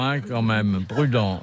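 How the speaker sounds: ordinary talk, nothing marked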